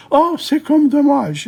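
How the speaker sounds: way up high, a hammed-up sigh of pity; mock dainty exclamation. Shown as a demonstration. Teacher showing students how to mix French into English, high and playful. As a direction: toss off the mixed-language phrase in a high voice.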